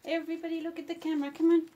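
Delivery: loud, high call to gather the dogs. Real, not acted. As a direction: call them over, loud and bright. Calling dogs to pose for photo.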